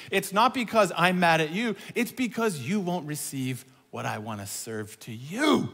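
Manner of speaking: usual speaking voice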